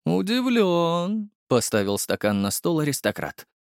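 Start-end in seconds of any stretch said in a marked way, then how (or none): 0.0-1.3 very slow, high, coolly amused; drawn-out cool remark. A narrator's reading. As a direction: stretch it out, unimpressed.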